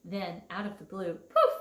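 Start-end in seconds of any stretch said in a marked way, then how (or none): 1.2-1.5 sudden high poof; magic poof. A narrator's reading. Narrator to kids, a high bright poof. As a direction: pop the word like a spell. Fairy appears.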